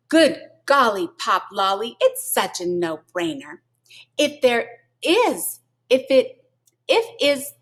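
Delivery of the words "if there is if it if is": slow, tripping over the words; stumbling. Real, not acted. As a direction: stumble and restart the line. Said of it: "I'm having heartburn on that".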